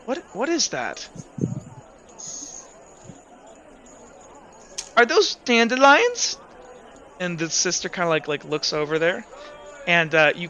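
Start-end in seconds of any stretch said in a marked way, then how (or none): 0.0-6.4 put-on little girl voice. Girl character wonders at spores.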